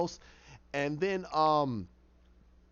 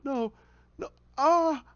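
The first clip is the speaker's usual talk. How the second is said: high cry of rising distress; protest, climbing into a cry; distressed refusal; 9 semitones higher, 3 dB louder, slower than usual. Retold or imitated. Man resisting his other self, distressed.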